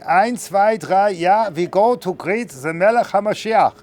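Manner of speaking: hammed-up German accent, counting off; mock German accent. Germans heading to greet Mashiach, mock German accent. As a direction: bark it like a cartoon German. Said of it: "The Germans are going".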